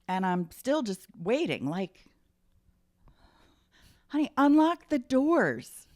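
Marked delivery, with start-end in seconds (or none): 4.1-5.9 calling out. Retold or imitated. Calling to dad from car.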